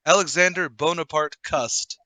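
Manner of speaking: usual speaking voice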